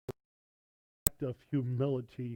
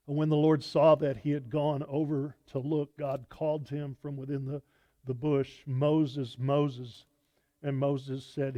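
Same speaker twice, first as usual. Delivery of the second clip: raised, projected reading of the passage; scripture reading; 7 dB louder than usual. Acted. Preacher reading Exodus aloud to the congregation, projected and louder. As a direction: proclaim the verses to the congregation.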